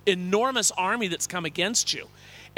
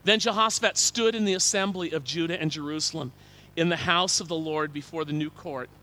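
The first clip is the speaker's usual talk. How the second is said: ordinary talk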